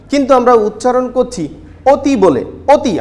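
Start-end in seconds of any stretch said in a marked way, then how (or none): 1.9-2.9 অতি with a rounded first vowel, o-ti. Shown as a demonstration. Teacher models অতি for students as rounded oti. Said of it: "অ এর মতো উচ্চারণ করছি না".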